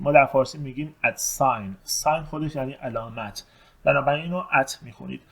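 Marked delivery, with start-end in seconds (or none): none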